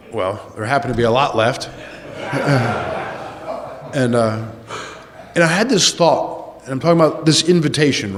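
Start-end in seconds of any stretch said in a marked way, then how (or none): 1.7-3.9 soft voiceless chuckle; an airless laugh at the leftovers; breathy silent laugh. Real, not acted. Laughing to the group about lots of chicken parm left over.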